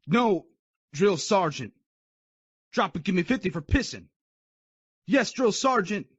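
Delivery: loud clipped replies; military shouting. Acted. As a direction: sound off loudly. Recruit and sergeant exchange shouts.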